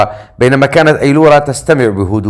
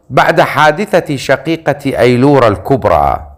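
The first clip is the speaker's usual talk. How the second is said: ordinary delivery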